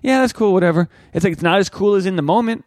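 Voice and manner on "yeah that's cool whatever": high bored mock approval; dismissive. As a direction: shrug it off, pitched high. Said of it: "And I'm like".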